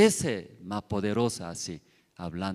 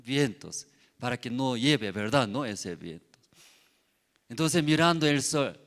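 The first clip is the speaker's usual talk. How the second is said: ordinary talk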